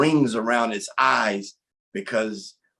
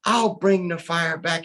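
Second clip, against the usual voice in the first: higher-pitched small creature voice; small spider volunteering, pitched up; little water spider; 6 semitones higher than usual. Acted. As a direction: pipe up as the tiny spider. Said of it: "The little water spider said".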